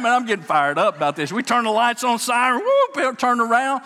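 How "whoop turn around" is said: normal talking voice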